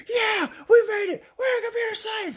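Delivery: high excited cheer shout; mock cheering. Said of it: "And they're cheering".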